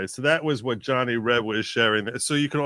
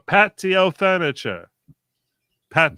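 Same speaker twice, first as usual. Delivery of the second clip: "patio furniture" said high and slow as a clumsy Irish "paddy o' furniture"; bad Irish accent; 7 semitones higher, 6 dB louder, slower than usual. Streamer reading the joke, mangling "patio furniture" into a bad Irish accent. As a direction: ham up "patio furniture" in a mock Irish lilt. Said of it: "That's your Irish accent?".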